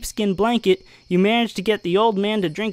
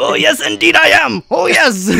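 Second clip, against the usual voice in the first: high, loud Scottish dwarf; proud Scottish dwarf boast; Scottish dwarf confirming; Scottish accent; 8 semitones higher, 7 dB louder than usual. Acted.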